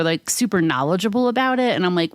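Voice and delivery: ordinary talk, nothing marked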